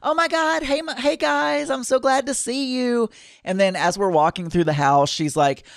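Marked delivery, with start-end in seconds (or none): 0.0-3.1 much higher, gushing hello; happy bouncy greeting. Retold or imitated. Realtor greeting buyers at the house, gushing and much higher. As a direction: gush like a thrilled realtor greeting clients. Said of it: "she is so happy, happy, bouncy".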